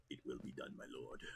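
low, soft obedient murmur; servile villain reply. Acted. Clone answering the dark lord, low and soft. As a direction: answer your master, quietly. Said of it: "I really wanna try voice acting".

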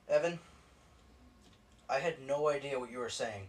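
ordinary delivery

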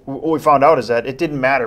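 ordinary delivery

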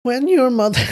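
put-on high, thin old grandmother voice. Shown as a demonstration. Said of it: "Should I do a grandmother voice?".

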